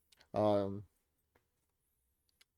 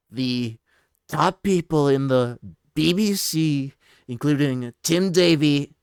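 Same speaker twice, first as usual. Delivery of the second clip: Trump voice; 6 semitones higher, 14 dB louder than usual. Shown as a demonstration. Streamer reading a Truth Social post as Trump. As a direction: boom it out like Trump at a rally. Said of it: "like, a Trump voice".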